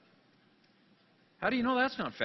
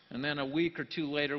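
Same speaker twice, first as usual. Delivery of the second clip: ordinary talk, nothing marked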